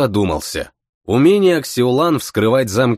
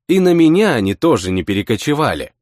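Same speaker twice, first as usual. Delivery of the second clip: ordinary delivery, nothing marked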